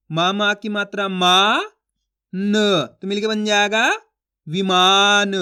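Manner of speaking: slow, stretched spelling drill; teacher sounding out a word; syllable-by-syllable reading. Shown as a demonstration. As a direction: draw out each syllable, then blend the word. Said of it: "एक अक्षर का उच्चारण करके इनको आपस में लाके पढ़ना है".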